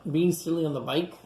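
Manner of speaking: plain reading voice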